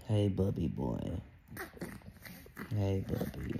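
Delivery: dad cooing at his newborn son, softly. Real, not acted.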